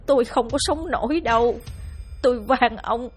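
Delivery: a touch of weeping as she pleads; sobbing plea. Acted. Mother clings to husband's legs begging for her son, tearful plea. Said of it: "khóc nức nở van xin".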